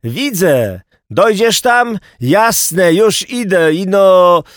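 high, loud shouted exchange; calling out. Acted. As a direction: holler back and forth over a distance. Acted shouted exchange under bridge.

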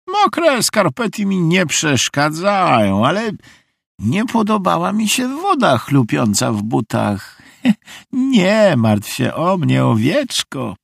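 high, slow, drawn-out character voice; breezy odd guest, pitched high; eccentric visiting lady. A narrator's reading. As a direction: stretch it out in a high, old-fashioned lady's voice.

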